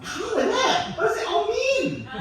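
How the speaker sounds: very high, loud wail of confusion; mock bewilderment. Comedian reacts to baffling whale-sound show, high mock wail. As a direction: cry it out in exaggerated bafflement.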